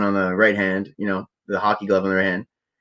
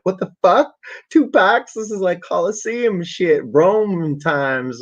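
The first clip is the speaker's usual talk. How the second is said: much higher, indignant retold disbelief; mock incredulous protest; 9 semitones higher, much slower than usual. Retelling his protest at a noodles-for-fighting offer, mock incredulous. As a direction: replay your disbelief in a high-pitched voice.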